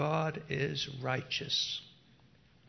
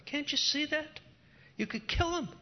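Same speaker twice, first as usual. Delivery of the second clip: urging voice; 12 semitones higher than usual. Retold or imitated. Ruffians urging David to kill.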